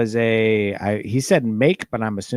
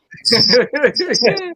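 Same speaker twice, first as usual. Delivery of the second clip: high, loud burst of laughter; 13 semitones higher, 8 dB louder than usual. Real, not acted. Reacting to co-host's British accent bit, loud laughing. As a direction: crack up loudly.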